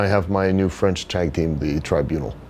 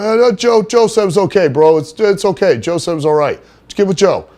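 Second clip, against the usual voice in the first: much higher and louder stammering meathead excuse; caught-in-the-act gym bro, pitched way up; Johnny's bro voice; 10 semitones higher, 5 dB louder than usual. Retold or imitated. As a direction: talk your way out with a high, eager bro voice. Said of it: "that's how he would talk".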